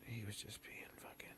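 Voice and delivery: hushed whisper so he can't hear. Real, not acted. Whispering an insult about a guy nearby. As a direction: whisper it under your breath. Mocking friend behind his back.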